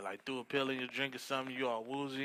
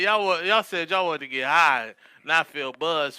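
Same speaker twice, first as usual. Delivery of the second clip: loud, pushy hustler; loud boast; 3 semitones higher, 9 dB louder than usual. Retold or imitated. Guy with the crack pipe pushing it loudly. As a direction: push the hard stuff loudly.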